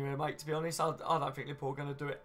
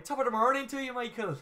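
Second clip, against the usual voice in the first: higher-pitched, jokey Irish accent; Irish impression; 8 semitones higher than usual. Shown as a demonstration. Streamer showing a viewer his rusty party piece, mock Irish accent. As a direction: put on a cheery Irish greeting, then break into a laugh. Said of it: "I haven't done my Irish impression".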